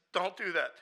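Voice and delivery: loud, high angry warning. Retold or imitated. Angry friend objecting to the word loved, loud warning. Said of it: "was so angry".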